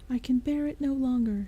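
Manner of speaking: put-on Sarah at the end of her rope with the baby; exclaiming. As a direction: cry out in desperation. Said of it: "she exclaimed".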